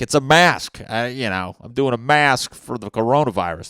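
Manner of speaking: high, loud indignant protest; loud, high-pitched mock outrage at accusers; defensive yell. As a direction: shout it out, exasperated and defensive. Defending himself, yelled at over blackface.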